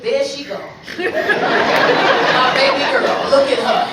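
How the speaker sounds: loud, very slow, cooing praise; old boyfriend fawning; doting old man. Retold or imitated. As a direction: gush over baby girl. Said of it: "he said the same shit".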